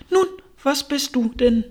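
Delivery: high, impatient demand; challenging pigeon. A narrator's reading. Pigeon presses stammering Alice for an answer, challenging.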